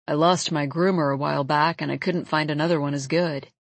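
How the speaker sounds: low-pitched man's voice, casual; laid-back male love interest. Acted. Jonah explaining his shaved face to her, low casual voice. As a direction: drop the pitch for an easygoing man.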